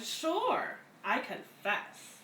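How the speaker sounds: ordinary delivery, nothing marked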